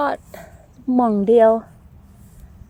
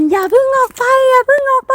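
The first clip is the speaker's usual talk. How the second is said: mock shout, much higher and louder; imitating a shout; 12 semitones higher, 6 dB louder than usual. Retelling her sister's shout to viewers, mock yelling. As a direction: echo her sister's yell, pitched way up. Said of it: "พี่ก้อยตะโกน".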